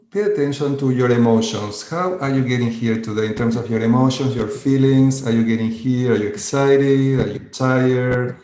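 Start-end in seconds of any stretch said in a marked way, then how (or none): none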